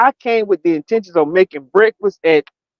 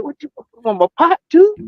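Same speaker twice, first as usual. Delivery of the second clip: mock disbelief; 7 semitones higher than usual. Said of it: "I said".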